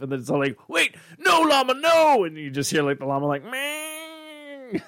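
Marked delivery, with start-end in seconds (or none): 0.7-2.2 much higher, louder cartoon yelp; panicked cartoon voice. Acting out cartoon character panicking. 3.4-4.8 high soft llama bleat. Retold or imitated. Imitating the llama sound.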